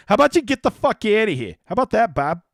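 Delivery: sudden playful snap, high and loud; fake-angry blast at a viewer; mock aggressive. Mock aggressive outburst at chatter.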